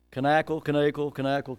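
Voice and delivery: "Knackle" alternating short A and long A; alternating pronunciations. Shown as a demonstration. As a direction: chant "Knackle" as kuh-NACK-ul, kuh-NAKE-ul by turns. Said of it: "That's how I, I was able to learn".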